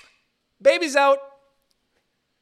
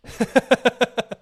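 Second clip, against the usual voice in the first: loud high laugh; 8 semitones higher, 7 dB louder than usual. Real, not acted. Host cracking up at own joke, loud high laugh.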